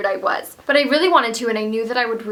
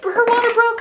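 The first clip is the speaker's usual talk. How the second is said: husband quoted; 12 semitones higher, 3 dB louder than usual. Retold or imitated. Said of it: "he's like".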